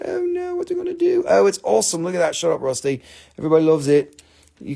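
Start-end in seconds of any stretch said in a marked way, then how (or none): 0.0-1.2 squeaky high mock panic; hammed-up high squeak of distress; mock despair. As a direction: fake wail over the poor crystals. Mock distress over cut crystals.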